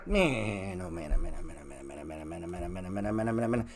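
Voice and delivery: low, very soft repeated mumble; exasperated muttering. Acted. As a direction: mutter it over and over under your breath. Muttering to himself in mock exasperation.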